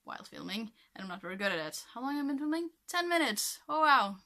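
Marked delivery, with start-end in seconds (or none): 1.9-4.2 high surprised exclamation; suddenly surprised. Real, not acted. Surprised at filming time.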